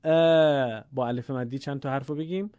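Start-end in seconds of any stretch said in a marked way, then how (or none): 0.0-0.8 «ا» held long, light and fronted; long light Arabic «ا»; thin long alef. Shown as a demonstration. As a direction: stretch «ا» as a thin "aa", like English "add".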